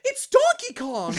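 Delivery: put-on high, pinched warm-up line in a boyish voice; Pit's voice. Shown as a demonstration. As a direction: shout it out like an eager young angel. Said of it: "getting into his voice are".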